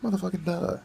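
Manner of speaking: shouted sarcastic duh; exasperated mocking duh. Real, not acted. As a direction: hammer home how obvious it is. Mocking obvious crime statistic.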